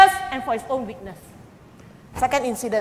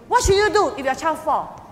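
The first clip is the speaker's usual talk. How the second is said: usual speaking voice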